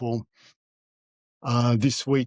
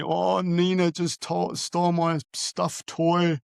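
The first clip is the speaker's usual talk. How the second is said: put-on higher, slow child's complaint; upset little girl; 5 semitones higher, slower than usual. Father retelling his crying daughter's answer, higher slow child voice. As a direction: imitate a small child's hurt explanation. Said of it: "And she said to me".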